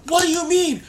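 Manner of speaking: high, loud indignant outburst; indignant challenge. Acted. Storyteller challenging T A for not breaking up, indignant. Acted quarrel over breaking up.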